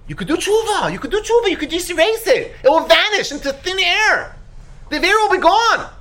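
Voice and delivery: high, elated proclamation; acted excited encouragement. Someone telling a sinner it can vanish, excitedly. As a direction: announce the good news in a soaring pitch. Acted good news nobody hears.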